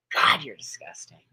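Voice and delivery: whispered low in contempt; disgusted whisper. Real, not acted. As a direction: hiss it with disgust. Disgusted aside to flaggers.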